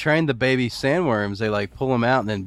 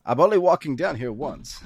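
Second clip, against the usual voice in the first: Christopher Walken; 5 semitones higher than usual. Acted. Spoofing the Emperor coming downstairs, actor impression. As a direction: play it as a comic parody of the actor. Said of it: "This is the Christopher Walken scene?".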